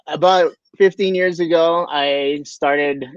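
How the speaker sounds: ordinary talk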